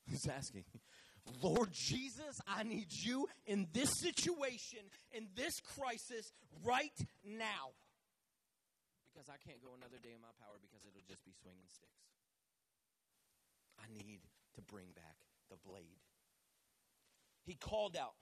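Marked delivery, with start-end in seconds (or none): 1.4-7.7 loud, drawn-out emphatic plea; crying out in prayer. Acted. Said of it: "What's crying out? He's asking". 9.1-16.0 soft, low plea to God; quiet earnest prayer. Acted. Prayer sinks into quiet pleading.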